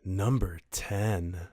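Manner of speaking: low, slow and seductive; sultry voice. Pretend. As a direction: breathe it out like a perfume ad. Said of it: "Oh my God. That was way better than I thought".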